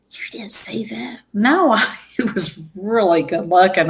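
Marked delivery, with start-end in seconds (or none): 0.1-1.2 hushed unvoiced whisper. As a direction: whisper it as a secret. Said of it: "She says".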